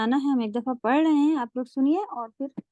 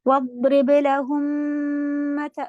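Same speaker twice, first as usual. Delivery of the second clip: recitation; 2 semitones higher, much slower than usual. Shown as a demonstration. Models the verse recitation.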